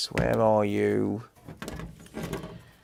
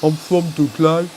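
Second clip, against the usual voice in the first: put-on louder, higher broad Dudley accent; 4 semitones higher, 6 dB louder than usual. Streamer doing one more take of the Dudley line, thick Dudley accent. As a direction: lay the Dudley twang on thick. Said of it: "That's how they talk there".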